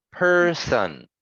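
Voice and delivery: "Person" with PER held high, son dropping; syllable demo. Shown as a demonstration. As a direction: stretch and lift the first syllable of "Person". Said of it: "which syllable is stressed".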